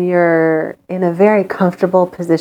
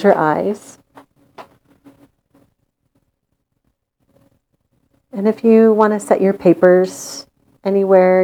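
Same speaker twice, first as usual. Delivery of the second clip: guided meditation. Acted. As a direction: lull them into calm with long pauses.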